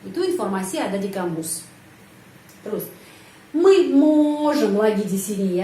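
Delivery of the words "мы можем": мы можем very slow, loud, stress on the O; slow stress demo. Shown as a demonstration. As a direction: stretch мы можем, leaning on the O.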